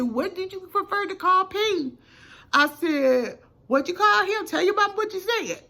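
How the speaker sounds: high, pressing interrogation; confronting demand. Retold or imitated. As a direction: corner the kid with each question. Confronting the boy in office.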